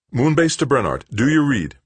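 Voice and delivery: high, loud radio call. Acted. Calling over the radio.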